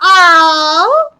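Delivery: high, loud laughing coo; laughing aww. Real, not acted. Viewer cooing at a sweet couple moment, laughing. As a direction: melt at the cute moment. Speaker coos at cute moment.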